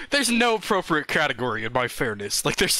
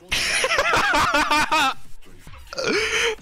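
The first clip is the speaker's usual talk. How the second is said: high burst of laughter. Real, not acted. Bursts out laughing after twerk.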